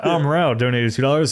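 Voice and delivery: usual speaking voice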